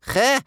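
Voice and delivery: loud, high raspy "heh"; rasped scoff. A narrator's reading. Scary grandmother mocks the boy's plea, rasping. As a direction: scoff it out with a furious rasp. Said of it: "rasped the doom-filled, furious voice".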